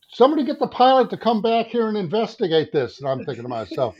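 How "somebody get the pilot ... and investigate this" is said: high, loud urgent call for help; frightened passenger calling for the pilot, high and loud; alarmed demand. Retold or imitated. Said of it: "And it's like".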